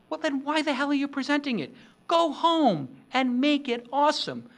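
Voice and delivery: emphatic outburst. Real, not acted. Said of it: "beep that out".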